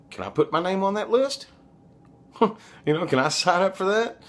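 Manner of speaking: high, eager and laughing; eager excited. Retold or imitated. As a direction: jump at the offer, thrilled. Eagerly retelling his own reply.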